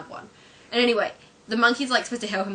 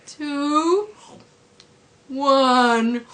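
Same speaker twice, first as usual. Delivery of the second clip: higher, louder drawn-out counting; laboured cartoon countdown; Homer counting; 6 semitones higher, 7 dB louder than usual. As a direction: drag out each number loudly. Homer still counting hours later.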